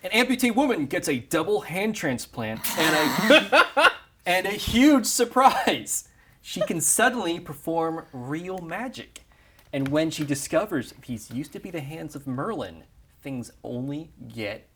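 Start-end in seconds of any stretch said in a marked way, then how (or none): 0.0-9.2 laughing through the pitch; giggling while reading it out. Real, not acted. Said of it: "laughing while pitching".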